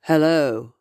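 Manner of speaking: a hint of a low ghostly man's voice, lightly voiced; deep male voice. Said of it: "this deep voice said".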